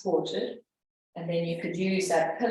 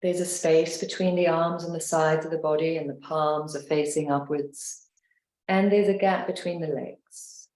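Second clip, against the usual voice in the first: guided meditation; 2 semitones lower than usual. Acted. Leads a guided relaxation.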